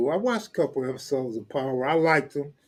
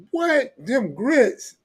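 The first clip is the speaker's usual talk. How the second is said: high, startled imitated mama; high surprised imitation of a mother; mock surprise; 9 semitones higher, 3 dB louder, much slower than usual. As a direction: jump up in pitch in disbelief. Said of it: "He like".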